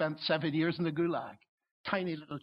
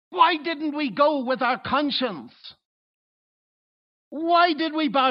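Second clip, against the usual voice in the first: anguished crowd regret; 8 semitones higher, 7 dB louder than usual. Retold or imitated. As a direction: wail it like someone who bowed and wishes they hadn't. Imagined crowd regretting their bowing.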